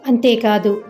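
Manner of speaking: ordinary delivery, nothing marked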